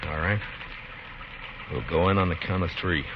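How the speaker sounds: low, soft, tense planning under fire; hushed. Acted. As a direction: keep your voice down, he's listening. Whispered planning pinned down.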